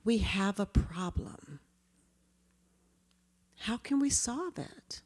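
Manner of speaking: slow, whispered and gentle; soft magic phrase. Shown as a demonstration. Teacher softly inviting the children to solve it. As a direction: hush it like a secret invitation. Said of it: "And then the magic phrase".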